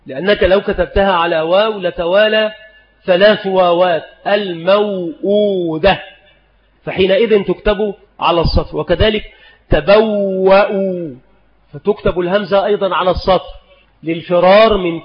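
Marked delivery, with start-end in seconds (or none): 4.3-6.0 الموؤودة drawn out syllable by syllable; sounded out slowly. Shown as a demonstration. Teacher sounds out example word. 9.7-11.2 تبوأوا stretched syllable by syllable; sounded out slowly. Shown as a demonstration. Teacher sounds out example word.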